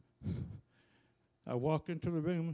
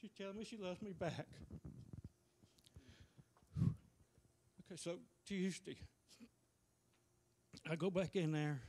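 choked up; 8 dB quieter than usual. Real, not acted. Husband breaking down over wife.

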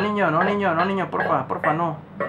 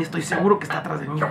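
ordinary talk, nothing marked

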